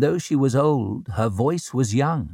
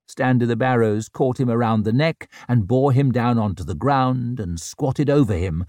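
ordinary delivery

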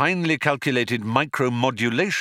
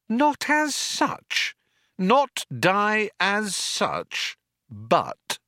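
high, slow, incredulous echo; pointedly mimicking a feeble excuse; mocking echo; 13 semitones higher, much slower than usual. A narrator's reading. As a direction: throw the words back, drawn out. Trillian mockingly echoes his hedge.

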